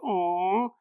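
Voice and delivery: soft, drawn-out "aw"; gentle mock sympathy; sympathetic aw. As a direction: coo in pity at a sad sight. Retold sympathetic onlooker seeing dog.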